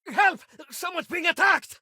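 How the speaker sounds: high panicked cry for help; shout for help. Acted. Shopkeeper screams to passersby, panicked shout.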